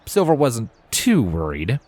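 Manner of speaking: ordinary delivery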